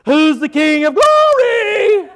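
slow ringing cry. Retold or imitated. All of heaven crying out to the gates, slow triumphant call. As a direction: ring it out as a question everyone knows. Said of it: "all of heaven cries out".

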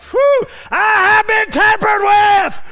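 high, slow, full-force shout of "I have been tampered with"; ecstatic shout. Acted. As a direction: cry it out in triumph. Preacher's climax shout.

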